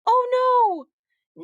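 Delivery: high, loud cry of dismay. A narrator's reading. Heidi seeing the crushed charms, loud dismay. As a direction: wail it at the broken charms. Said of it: "charms crushed".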